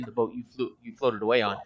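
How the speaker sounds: usual speaking voice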